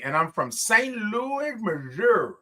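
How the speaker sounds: "Saint Louis" as slow high Louie, "Missouri" as Mizzur; playful local pronunciation. Acted. Host reads Denise's hometown, playing up Louie, Mizzur.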